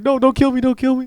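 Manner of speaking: high, loud panicked begging; hammed-up frightened plea; mock pleading. Retelling a man's frightened pleading.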